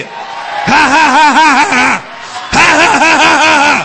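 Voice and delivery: high, loud, staccato ha-has; deliberate loud laughter. Shown as a demonstration. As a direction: belt out rolling belly laughs. Demonstrating laughter to congregation.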